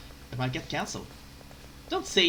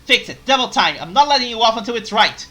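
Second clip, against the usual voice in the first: loud, higher stern orders; angry scolding; 5 semitones higher, 12 dB louder than usual. Acted. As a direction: bark orders at her. Master scolds maid.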